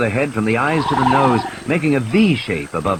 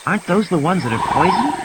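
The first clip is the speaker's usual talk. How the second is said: lightly voiced child, worried; 7 semitones higher, faster than usual. Child asks Grant.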